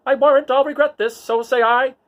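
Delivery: high, loud, prissy droid scolding; high-pitched indignant protocol droid arguing; fussy C-3PO. Acted. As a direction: scold the little droid like a huffy butler. C-3PO resigning himself.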